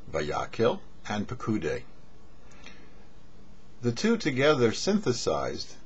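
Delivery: ordinary talk, nothing marked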